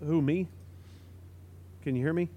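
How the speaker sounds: normal talking voice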